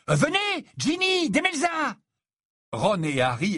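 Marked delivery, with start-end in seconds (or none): 0.1-2.0 voix aiguë, appel léger; presse-les de venir; rassemble les filles. Acted. Dean ushers teammates out. (Dean appelle Ginny et Demelza vers la fête, voix haute)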